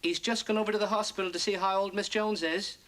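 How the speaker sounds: ordinary talk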